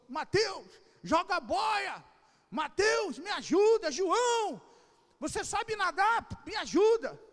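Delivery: high, loud shouts for rescue; acted cry for help. Sinking Pedro shouting to the others in the boat, panicked yelling. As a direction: yell to your friends as if drowning. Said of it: "Ele não falou assim".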